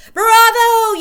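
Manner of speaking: very high, very loud cheer; audience yell. A narrator's reading. Witch audience cheering the Grand High Witch. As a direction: cheer it wildly. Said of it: "yelled the audience".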